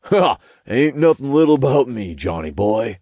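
high, cocky character voice; Todd snickering. A narrator's reading. Todd boasts to John about size, snickering. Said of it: "Todd snickered".

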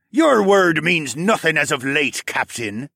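loud contemptuous jab; spat. Acted. Miner defying his captain, spat with contempt. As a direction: spit the words at the captain. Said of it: "the yellow-striped man spat".

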